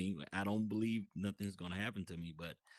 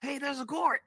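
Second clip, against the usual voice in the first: high, louder mock exclamation; excited call-out; 6 dB louder than usual. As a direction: imitate a friend excitedly pointing something out. Voices friend spotting the court.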